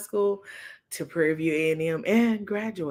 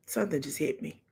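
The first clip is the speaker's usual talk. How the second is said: normal talking voice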